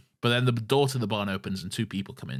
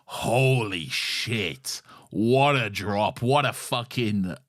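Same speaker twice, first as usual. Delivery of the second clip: slow and drawn out, in awe; awed slow emphasis; 4 dB louder, much slower than usual. Real, not acted. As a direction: stretch each word out, amazed. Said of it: "And it's like".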